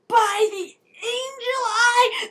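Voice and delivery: high, slow, laughing cry; hammed-up dramatic heroine; dramatic exclaim. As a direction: gasp it out theatrically. Clary's dramatic exclamation at door.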